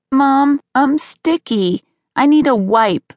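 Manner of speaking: put-on high, drawn-out kid's call from another room; little boy calling out. A narrator's reading. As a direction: call for mom like a sticky little kid. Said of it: "Brody called from the couch".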